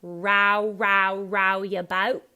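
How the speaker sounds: loud, exaggerated ow in "row" and "boat"; broad ow vowel. Shown as a demonstration. Coach exaggerating for the actor, broad ow vowel. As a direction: ROW as rao, hard and slow. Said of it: "really do it like ow".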